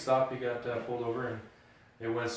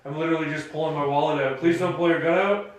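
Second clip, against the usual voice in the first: higher, louder, anxious plea; mock fearful pleading; 4 semitones higher, 6 dB louder than usual. Friend imitating himself fearing the cop, mock pleading. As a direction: beg not to get shot, half joking. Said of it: "He's like".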